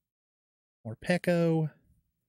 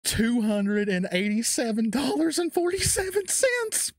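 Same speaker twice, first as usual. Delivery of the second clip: held-in scream; 8 semitones higher than usual. Real, not acted. Collector reading the price while everyone sleeps, held-in scream. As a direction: pitch climbing into a high squeal. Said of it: "I can't scream".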